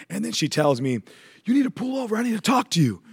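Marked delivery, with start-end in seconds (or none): 1.4-3.0 urgent church lady. Retold or imitated. Voicing the church lady's urgent plea.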